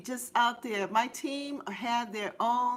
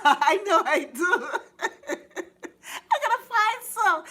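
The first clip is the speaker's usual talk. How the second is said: giggling through the words, louder and high; laughing; 8 semitones higher, 7 dB louder than usual. Real, not acted. Host laughing at the chat's pom-pom idea.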